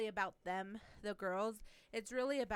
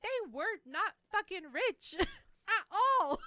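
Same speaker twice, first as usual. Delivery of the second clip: louder, slow, higher indignant disbelief; emphatic retold text; 10 semitones higher, 9 dB louder, slower than usual. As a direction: spell it out slowly, outraged. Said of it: "and I'm like".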